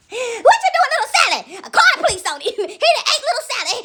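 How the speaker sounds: high-pitched alarm breaking into laughter; mock accusation. Neighbour reporting that he ate Little Sally, laughing mock alarm. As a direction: demand answers while cracking up. Acted confronting of the wolf.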